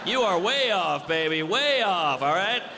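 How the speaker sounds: high, hammed-up sassy bragging; brazen woman boasting. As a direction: brag proudly to the chief, playing it up. Voices a boastful woman to the chief.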